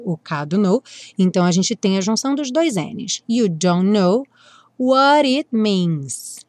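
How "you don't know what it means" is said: what it said "waɾ it", slow; flap T. Shown as a demonstration. Teacher models the flap in what it. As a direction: tap the T of what into it. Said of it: "o what ganhou o rararã no T".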